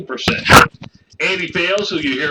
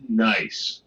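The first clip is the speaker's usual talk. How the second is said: usual speaking voice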